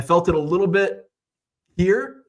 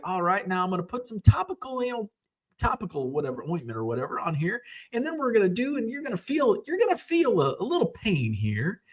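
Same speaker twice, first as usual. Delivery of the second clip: lightly voiced dentist, pitched higher and softer; play-by-play dentist; 7 semitones higher, 5 dB quieter than usual. Dentist warning a patient of a little pain, lightly imitated play-by-play. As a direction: talk the patient through each step in a lilting voice. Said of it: "he's, like, doing play by play".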